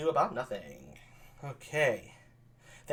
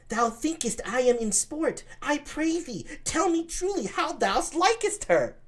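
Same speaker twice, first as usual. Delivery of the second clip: put-on much higher, slow boyish voice; pleading young Claudio; 13 semitones higher, slower than usual. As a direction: beg him to speak seriously.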